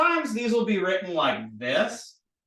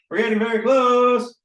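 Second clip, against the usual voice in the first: higher, louder, stretched on "close"; playful excitement; 6 semitones higher, 7 dB louder than usual. Real, not acted. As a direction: sing out "close" long and loud. Playful repeated encouragement, louder take.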